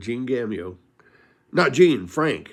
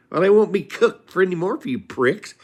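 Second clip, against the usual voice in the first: high, raised voice of an angry wife, hammed; mock angry wife; 8 semitones higher, 4 dB louder than usual. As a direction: spit it out at the ungrateful men.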